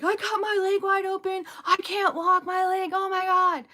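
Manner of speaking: high-pitched, over-the-top whine; mock freaking out. Stepmom mimics boy with scraped knee, freaking out. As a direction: imitate a child making a huge drama of it. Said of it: "Freaking out".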